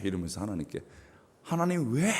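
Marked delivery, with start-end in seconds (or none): 1.4-2.1 high-pitched indignant plea; mock angry. Psalmist confronting God in his lament, acted angrily. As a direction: act out an angry challenge to heaven. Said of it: "이러면서 화를 내는 거예요".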